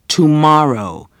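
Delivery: tomorrow said slow, high and loud; tomorrow pronounced clearly. A narrator's reading. Teacher calling out the word tomorrow, clearly and slowly. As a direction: project "tomorrow" clearly to the class. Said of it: "she pronounced clearly".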